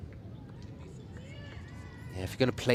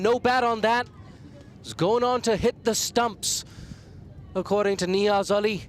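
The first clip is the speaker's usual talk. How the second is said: excited; 10 semitones higher than usual. Real, not acted. Wicket replay, still thrilled.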